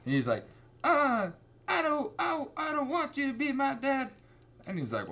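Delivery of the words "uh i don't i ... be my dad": high-pitched, hammed-up crying teen; whiny teenage nephew. Nephew rejecting his uncle as a new dad, whiny teen voice. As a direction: wail it like a mock-upset kid.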